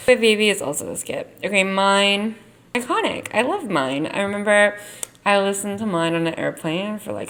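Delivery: usual speaking voice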